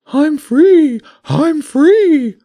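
high, loud bird voice; a freed pigeon crowing with delight, high and loud; jubilant pigeon voice. Acted.

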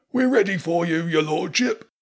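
high herald voice calling back; called back. A narrator's reading. Calling into drain. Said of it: "called back into the drain".

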